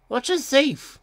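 high-pitched, questioning engine character; puzzled little engine, high. A narrator's reading. Engine Ben asks in character.

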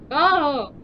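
loud, drawn-out "oh"; surprised realisation. Real, not acted. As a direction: let out a loud oh of sudden discovery. Sudden realisation in the game puzzle.

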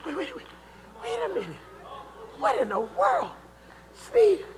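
hushed, high-pitched bewilderment; soft incredulous muttering to himself; stunned disbelief. Acted. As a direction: act dumbfounded, broken by long pauses. Acts stunned at Solomon's words.

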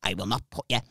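low, soft put-on murmur; reassuring friend's voice. Friend promising the teller a safe drive, low soft reassurance.

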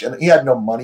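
ordinary delivery

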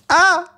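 sudden high, loud "ah"; startled yelp. Real, not acted. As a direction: yelp at an unexpected hit. Hit in the game.

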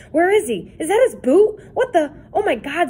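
usual speaking voice

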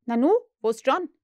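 put-on puzzled boy looking round; lightly voiced boy asking after John; Peter's voice. A narrator's reading.

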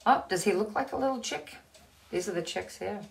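plain reading voice

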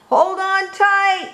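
high, slow, drawn-out call; called warning. A narrator's reading. Dad calling to Froggy on the bike, high drawn-out shout. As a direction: call it out high across the distance.

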